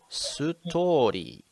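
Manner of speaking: sounding it out slowly; drawn-out syllable by syllable. Shown as a demonstration. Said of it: "and you go".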